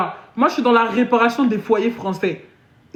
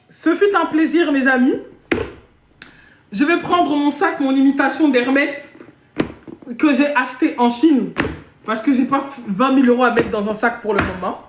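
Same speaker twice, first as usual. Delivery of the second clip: ordinary delivery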